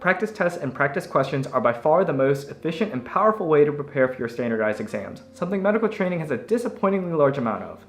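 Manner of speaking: upbeat sponsor read voice. Acted. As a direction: sell it brightly like an ad.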